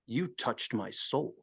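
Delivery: low, slow, soft intensity; hushed, drawn-out eerie murmur; creepy stranger. Retold or imitated. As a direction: imitate an unhinged man right in someone's face. Acting out crazy shirtless man up close.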